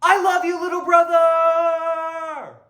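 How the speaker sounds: high, loud, slow affectionate yell; big brother hollering his love, drawn out. Retold or imitated. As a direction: shout it out as an embarrassing big brother. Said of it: "my brother yelled".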